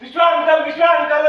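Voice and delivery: high, loud mimicked shouts of youngsters; imitating boys shouting for someone; imitated calling out. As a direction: call out like excited kids hailing an uncle. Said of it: "ಅಂತ ಕೂಗ್ಕೊಂಡ್ರು".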